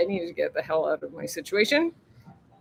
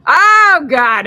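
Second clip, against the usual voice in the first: sudden loud high "Oh, God"; jolted by something; loud startled gasp of words; 8 semitones higher, 13 dB louder than usual. Real, not acted.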